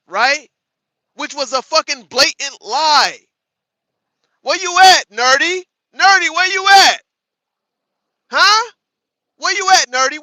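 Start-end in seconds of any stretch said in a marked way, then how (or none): 4.4-10.2 loud, higher taunting call into chat. Real, not acted. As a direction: call him out loudly, daring him to answer. Calling out a chat user.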